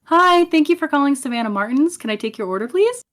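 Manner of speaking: sweet, lilting phone voice; customer service voice. Shown as a demonstration. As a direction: answer the phone ever so sweetly. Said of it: "Oh my god, that is so different".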